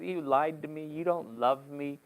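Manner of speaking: slowing, puppy-dog-eyed accusation; lightly acted hurt lover guilt-tripping; mock wounded pleading. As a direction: plead with a mock pout, dragging the last words. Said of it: "she's all mad ... puppy dog eyes".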